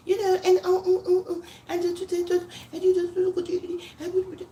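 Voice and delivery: high, put-on hoity-toity chatter; mock someone faking it with high, halting filler noises; fake posh talk. Speaker mocking people trying to be fake, put-on high posh voice. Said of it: "trying to be fake and do doddish".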